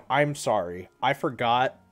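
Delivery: ordinary delivery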